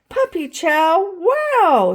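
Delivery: high, loud, slow surprise; teacher's loud delighted wow; amazed. A narrator's reading. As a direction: marvel at it. Teacher amazed at the puppy chow.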